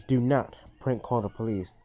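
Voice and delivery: ordinary delivery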